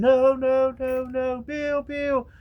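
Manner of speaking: high frightened screams; family screaming to Bill. Retold or imitated. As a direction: plead in terror. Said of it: "They were screaming".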